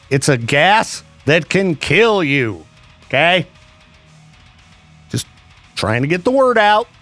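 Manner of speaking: ordinary talk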